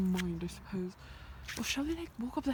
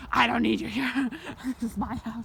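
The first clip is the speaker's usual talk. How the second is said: louder, huffy animal voice; mock sheep telling her off; angry sheep voice; 8 dB louder than usual. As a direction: play the territorial sheep. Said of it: "imagine just come running like".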